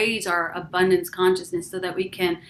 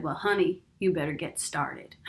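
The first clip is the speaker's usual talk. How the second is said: lightly imitated Southern woman, dropping lower at the end; Southern accent; 6 semitones lower, 5 dB quieter than usual. Woman preacher advising an eager admirer, light Southern accent. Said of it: "She had a Southern accent".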